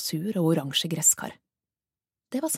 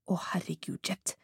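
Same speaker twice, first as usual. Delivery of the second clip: much softer, broken whisper; despairing whisper; 7 dB quieter than usual. Acted. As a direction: whisper her name in shock. Caller breaks down softly.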